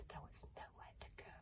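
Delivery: plain reading voice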